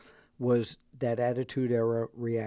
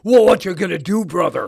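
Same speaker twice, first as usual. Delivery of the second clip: Hulk Hogan impression; 5 semitones higher, 9 dB louder than usual. Quotes Hulk Hogan catchphrase.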